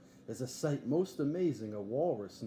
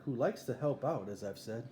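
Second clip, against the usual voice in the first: usual speaking voice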